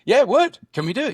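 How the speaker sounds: loud, high burst of excitement; bursting out yes, it would; eager exclaim. Retold or imitated. Pilot pitching a drone stunt to the project manager, loud and eager.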